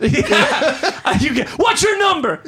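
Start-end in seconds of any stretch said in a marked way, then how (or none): 1.5-2.4 high-pitched shouted demand; mock yelling. Driver yelling at someone texting, mock angry shout. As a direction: call it out to the next car, hammed up.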